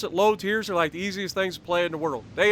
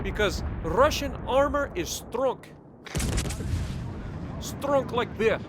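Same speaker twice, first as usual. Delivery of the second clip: mock Russian boast; 3 semitones higher, slower than usual. Joke Russian boast, slow.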